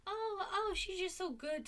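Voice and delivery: gushing parent. Retold or imitated. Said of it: "And just this".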